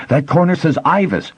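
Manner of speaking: high, eager exclamation; blurting out the discovery; excited. A narrator's reading. Boy pointing at the screen, excited. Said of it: "Pimer said excitedly".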